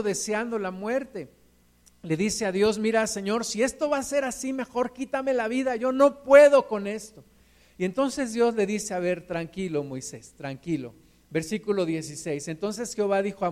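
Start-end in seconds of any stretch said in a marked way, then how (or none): 2.8-7.2 higher, building to a louder cry; a desperate Moses who can't take any more; rising from plea to loud outcry; despairing plea. Retold or imitated. 8.9-10.9 calm him down gently. Retold or imitated. God calming Moses.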